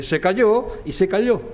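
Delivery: "se cayó" first with y, then "se cayó" with a lateral ll; y versus ll contrast. Shown as a demonstration. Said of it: "se distingue la Y de la L". ("se cayó" con y, y luego "se calló" con ll lateral)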